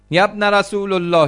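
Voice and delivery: high, loud calling plea; pleading beggar. Acted. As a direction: call out for alms like a blind beggar. Blind beggar calls out for alms.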